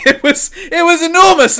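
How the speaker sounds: high, loud laughing words. Real, not acted. Recalling the enormous spider, laughing high and loud. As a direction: say it still laughing from the fright.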